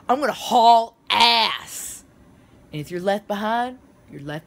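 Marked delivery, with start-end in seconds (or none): 0.1-2.0 emphatic. Acted. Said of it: "emphatic boast".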